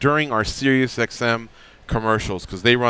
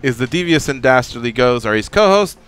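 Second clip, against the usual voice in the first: loud, high hype host intro; radio announcer; 5 semitones higher, 5 dB louder than usual. Acted. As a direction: sell the show like a big announcer. Host's big show-opening introduction.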